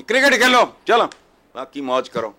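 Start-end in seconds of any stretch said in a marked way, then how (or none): 0.0-1.0 loud, high-pitched jab; real exasperated shout; scornful outburst. Real, not acted. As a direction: throw it out loudly in disgust. Scornful outburst after low aside.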